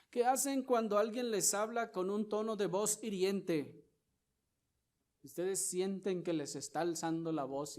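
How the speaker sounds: high, loud, driving sermon delivery; forceful preaching. Acted. Pastor confronting the brethren about revenge, loud preaching. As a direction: call out the congregation with fire.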